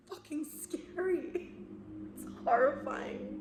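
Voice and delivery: high-pitched burst with a real laugh; high, breaking into laughter; laughing admission. Real, not acted. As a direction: blurt it out high, laughing between phrases. Admits fear with a laugh.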